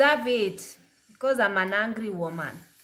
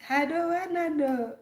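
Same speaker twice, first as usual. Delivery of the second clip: imitating Kenyan [name]; 6 semitones higher than usual. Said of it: "the Kenyan [name]".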